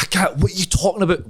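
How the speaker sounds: be shocked at your son; mortified mum. Retold or imitated.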